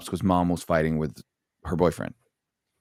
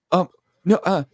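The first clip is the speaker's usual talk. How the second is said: high, louder mock fluster; flustered mother; 12 semitones higher, 4 dB louder than usual. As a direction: imitate someone caught out and stammering. Mother caught out, stammering.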